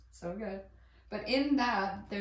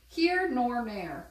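slow, louder mock-correction; 3 semitones higher, 5 dB louder, much slower than usual. Real, not acted. Laughing at her own flubbed idiom, slow and louder. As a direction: drag it out as a joke fix. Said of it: "correcting herself, laughing".

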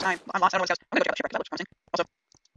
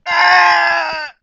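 startled scream; 9 semitones higher, 15 dB louder than usual. Real, not acted. Sudden monster attack scream.

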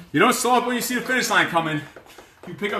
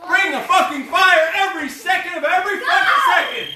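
louder and higher, laughing shout; hype shout; 8 semitones higher, 4 dB louder than usual. Real, not acted. Coach firing up the class, shouting with a laugh. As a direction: fire the class up.

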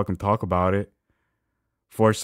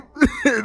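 high mocking laugh; 4 dB louder than usual. High laugh mid-mock.

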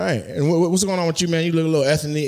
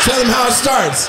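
higher, raised-voice urging; calling out; 7 semitones higher than usual. Acted. Comic egging on Dominique before the crowd, calling out. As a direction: call it out high to egg him on. Repeats line, second time raised.

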